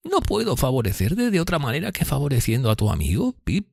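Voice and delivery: high character voice, earnest; kindly Miss Havisham. Acted. Miss Havisham offering to help Pip, high voice.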